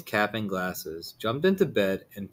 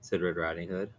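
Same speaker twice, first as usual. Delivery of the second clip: ordinary delivery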